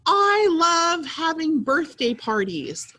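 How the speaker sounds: high, slow and thrilled; excited. Shown as a demonstration. Teacher demonstrating an excited voice. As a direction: show real excitement about the party. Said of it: "the excitement in your voice".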